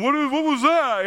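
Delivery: a touch of a startled neighbor calling out; neighbor's voice. Retold or imitated. Acting out the alarmed neighbor.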